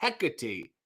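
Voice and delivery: Hecate tried softly as HEK-uh-tee; practising pronunciation. Shown as a demonstration. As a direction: quietly rehearse Hecate in three syllables, heck-uh-tee. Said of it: "I'm gonna try my best".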